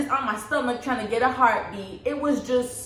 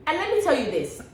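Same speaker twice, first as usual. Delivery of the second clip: usual speaking voice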